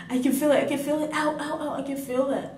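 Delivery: high, panicked, pained ow ow; panicked cry of pain. Retold or imitated. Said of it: "So I'm like".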